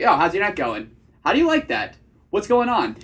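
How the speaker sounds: confident, casual date opener with hard endings; attractive vocal tonality. Shown as a demonstration. Coach shows how he talks on dates, casually confident. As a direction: drop each question firmly like a statement. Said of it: "that's a way more attractive vocal tonality".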